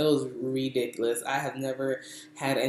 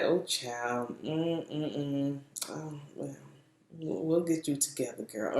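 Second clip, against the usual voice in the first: quiet, pitying mock-hairdresser voice; condescending; 7 dB quieter than usual. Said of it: "like, kinda condescending".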